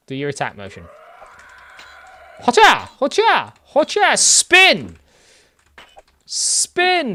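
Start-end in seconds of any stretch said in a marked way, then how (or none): 2.4-4.2 high, loud gleeful hotcha; excited battle cries. Real, not acted. Gleeful attack shouts in combat. 4.2-7.2 high gleeful shouted spin; excited shout. Real, not acted. Shouting moves during an attack.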